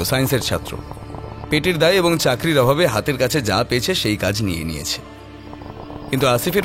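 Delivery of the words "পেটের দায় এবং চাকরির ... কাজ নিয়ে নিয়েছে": usual speaking voice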